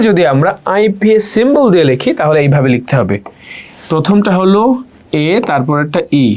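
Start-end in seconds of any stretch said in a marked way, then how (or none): none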